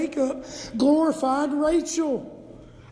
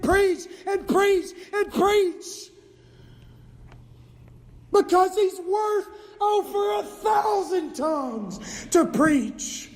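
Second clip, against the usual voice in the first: full-force preaching; 7 semitones higher, slower than usual. Acted. Preacher exhorting the room, crying out at full force. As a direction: preach it like fire from the pulpit.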